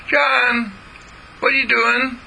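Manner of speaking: put-on six-year-old sister calling from the house; little girl calling. Sister Jean calls out.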